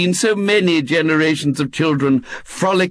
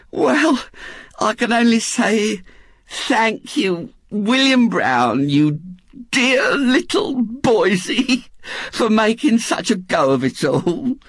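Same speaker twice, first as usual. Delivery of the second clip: high, doting woman's voice, lingering on phrases; Missus Bott, affectionate; 7 semitones higher than usual. A narrator's reading. Missus Bott thanks her dear little boysie, affectionate. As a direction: gush with fond gratitude.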